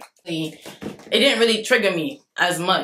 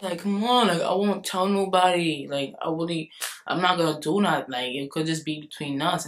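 lower, softer persuading voice; mock coaxing; 3 semitones lower, 6 dB quieter than usual. Boy promising he won't tell nobody, coaxing softly. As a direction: imitate a boy quietly talking her into it.

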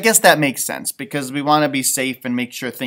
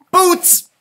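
perky boots; 13 semitones higher, 7 dB louder than usual. Acted. Pam says boots.

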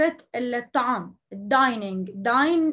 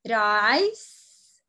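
slow, clear repeat; 4 dB louder, much slower than usual. Shown as a demonstration. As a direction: do you want rice stretched slowly.

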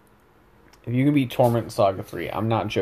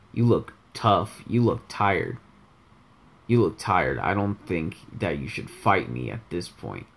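low monotone with a little emphasis; Rice; 5 semitones lower, 3 dB quieter than usual. Shown as a demonstration. Character telling a tired opponent not to fight, low monotone. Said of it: "tries his best to just stay as monotone as possible".

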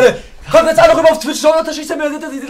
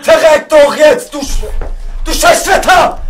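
loud, raised, drawn-out swearing; furious cursing; 4 semitones higher, 5 dB louder, slower than usual. Real, not acted. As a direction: curse the game at the top of your voice. Cursing at the game.

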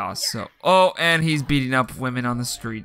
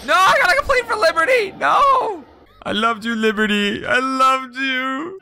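much higher wailing lament; hammed-up grief; 9 semitones higher than usual. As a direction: mourn her melodramatically. Mock grief for Liberty.

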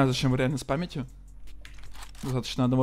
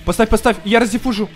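loud, high urgent call; urgent shout; 8 semitones higher, 9 dB louder than usual. Real, not acted. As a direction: shout it out in the heat of play. Urgent callout in game.

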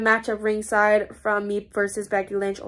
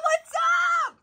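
shouted greeting. Real, not acted. Greeting Dakota as she walks up, high excited shout. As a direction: yell it out in excited welcome.

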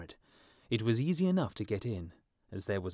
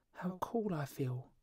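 put-on high, slow whisper of a sick child; feverish boy whispering; 8 semitones higher, 4 dB quieter, slower than usual. A narrator's reading. Sick boy in bed whispers to the swallow, high and slow.

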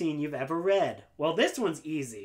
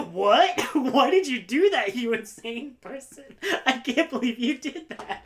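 laughing disbelief; 8 semitones higher, 6 dB louder than usual. Retold or imitated. Retelling her laughing disbelief.

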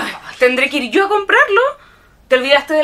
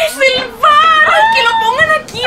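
high delighted squeal-shout; excited shout; 12 semitones higher, 3 dB louder than usual. Acted. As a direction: squeal with joy at the gift. Daughter thrilled at gift arriving.

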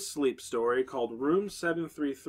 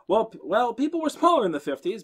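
higher, louder, flustered stammer; awkward and caught out, higher and louder; struggling RA; 9 semitones higher, 6 dB louder than usual. A narrator's reading. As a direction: scramble for an excuse, much higher. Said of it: "Beth struggled".

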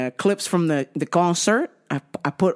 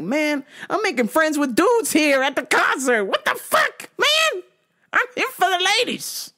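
squeaky mock outrage; 11 semitones higher than usual. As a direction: voice shoots very high in mock frustration. Retold own frustration.

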